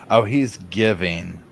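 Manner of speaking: ordinary talk, nothing marked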